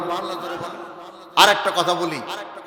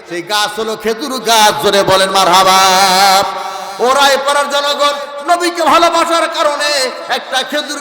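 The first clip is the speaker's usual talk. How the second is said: raised, ringing sermon chant; preaching; 5 semitones higher, 5 dB louder than usual. Acted. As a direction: proclaim it to the crowd with rising fervour. (জোরালো ওয়াজের সুর)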